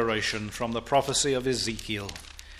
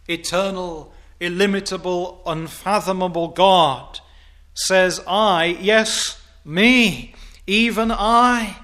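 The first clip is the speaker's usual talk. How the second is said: building to a shout; 7 semitones higher, 9 dB louder, slower than usual. Acted. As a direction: climb each title until it rings. Preacher building to a climax.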